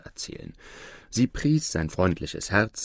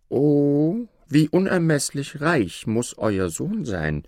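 high, drawn-out marvelling; wide-eyed awe, pitch lifted; astonished exclamation; 7 semitones higher, slower than usual. A narrator's reading. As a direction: exclaim slowly in amazement. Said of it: "rief er".

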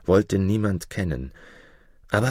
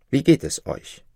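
higher, timid greeting; polite dwarf; 6 semitones higher than usual. A narrator's reading. Jakob greets his unknowing father, a little higher. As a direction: ask it shyly. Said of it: "sprach Jakob".

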